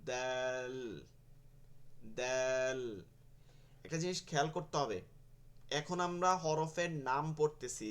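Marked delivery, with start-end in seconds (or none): 0.1-2.8 "দাল" with a long, level vowel; stretched dal. Shown as a demonstration. Teacher names the letter with its one-alif stretch, drawn out. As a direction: draw out the a of "দাল" before the l. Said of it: "এক আলিফ টান আছে".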